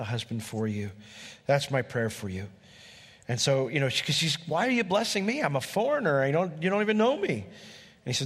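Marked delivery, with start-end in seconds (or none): 4.5-7.3 higher, lightly voiced bewildered Ruth; Ruth surprised. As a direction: ask it like a stunned stranger.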